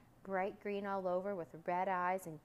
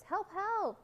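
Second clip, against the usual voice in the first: high, loud, urgent repeated cry; frightened cry; 12 semitones higher, 5 dB louder than usual. Acted. Second frightened voice calling for help, high loud cry. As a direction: call out high for rescue. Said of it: "cried the second".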